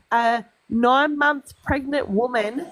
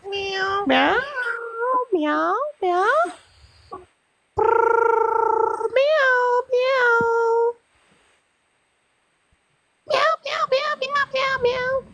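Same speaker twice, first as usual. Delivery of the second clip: very high squeaky meowing; calling a cat; 11 semitones higher, much slower than usual. Real, not acted. Calling a cat in with very high meows.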